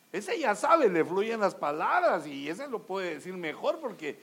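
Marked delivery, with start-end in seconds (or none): 0.1-0.9 higher and louder ese ya sabe, rising at sabe; emphatic. Shown as a demonstration. Preacher repeating loudly to the congregation, emphatic. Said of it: "Porque ese ya sabe".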